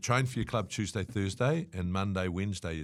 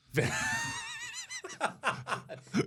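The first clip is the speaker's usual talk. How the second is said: big laugh. Real, not acted. Laughing at missing All Blacks selection.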